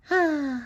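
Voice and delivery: high, long happy sigh; a dreamy sigh of pleasure; contented sigh. Acted. Narrator voicing SpongeBob, blissful high sigh.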